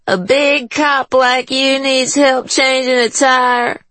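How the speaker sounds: high, slow, taunting drawl; mocking woman voice. A narrator's reading. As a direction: needle him slowly. Juanita mocks Chi.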